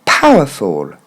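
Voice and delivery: "Powerful" as PAH-wuh-fol, falling low; correct English pronunciation. Shown as a demonstration. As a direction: stress "Pow", finish on a low fol. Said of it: "on reprend encore une fois".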